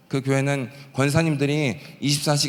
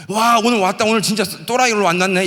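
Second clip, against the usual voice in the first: gleeful; 7 semitones higher, 4 dB louder than usual. Retold or imitated. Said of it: "이렇게 기분이 좋아야 되는 거예요".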